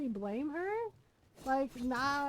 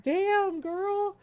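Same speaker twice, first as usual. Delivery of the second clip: impressed; 7 semitones higher, 7 dB louder than usual. Retold or imitated. Reacting to friend's anger.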